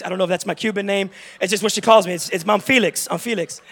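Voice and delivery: ordinary talk, nothing marked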